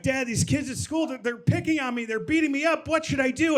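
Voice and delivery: put-on high, anxious kid voice; acted bullied kid asking for help; pleading child. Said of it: "Growing up, I'd be like".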